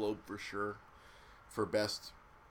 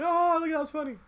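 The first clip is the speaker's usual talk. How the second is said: mocking crowd; 9 dB louder than usual.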